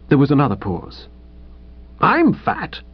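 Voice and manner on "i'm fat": higher, musing realisation; pensive. A narrator's reading. Visitor takes the hint about fat men, pensive. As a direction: ponder it, a little taken aback. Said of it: "in a rather pensive sort of voice".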